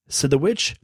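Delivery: plain reading voice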